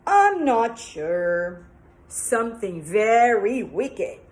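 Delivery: slow loud sinister; wicked witch. Acted. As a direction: menace her slowly. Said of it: "The witch said".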